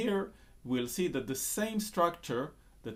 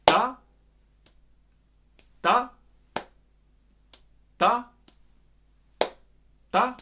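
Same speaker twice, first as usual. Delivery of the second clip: single high, loud "ta" followed by a long silence; sparse, emphatic "ta" hits with big gaps; widely spaced syllables; 8 semitones higher, 8 dB louder than usual. Shown as a demonstration. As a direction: punch each "ta" and wait out the beats. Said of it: "quite a big space between syllables".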